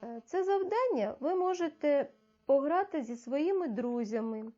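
ordinary talk

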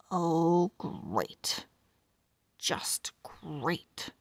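dropped low and soft, a deflated grumble; sarcastic groan. Acted. Pug grumbles to himself as Nuts shows the wing, sarcastic and deflated. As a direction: mutter it like the day just got ruined.

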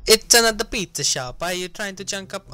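hammed-up Italian accent, starting high and loud; high-pitched cartoon Italian accent; Mario. Gamer voicing a rival pizza maker, exaggerated Italian accent. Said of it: "I was just doing Mario".

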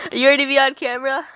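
loud, quick, teasing question to the camera; loud playful call. Real, not acted. Teasing her friend about being filmed, loud and quick. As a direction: fire it off fast and loud.

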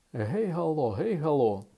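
high, ringing phone call hello; zebra calling out. Acted. Zebra queen hails down the phone, calling out high. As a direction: sing out a hailing hello. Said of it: "і кричала".

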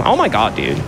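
usual speaking voice

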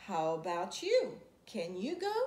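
ordinary delivery

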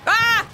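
sudden high loud shriek; startled scream. Real, not acted. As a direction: shriek in fright.

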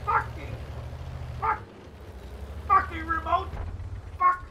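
high mock cursing dad in the background. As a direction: curse at the remote like a fed-up dad.

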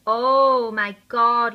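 very loud, slow delighted cry; excited. Real, not acted. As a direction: shout it out in awe. Swatching the gloss.

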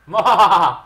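hammed-up gloating cackle; evil laugh. Player gloating over a level-up, evil laugh.

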